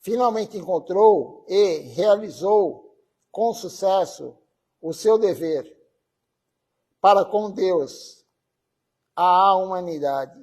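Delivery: slow, deliberate reading with long pauses between phrases; formal devotional tribute reading; solemn reading aloud. Acted. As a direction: read it out measured, phrase by phrase. Reads a tribute text aloud.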